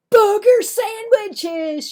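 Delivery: very high, loud, slow jeering yell; bully's shout. Acted. Clarence answers for Marvin, very high loud jeering shout. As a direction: bellow it out to embarrass him. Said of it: "shouted Clarence".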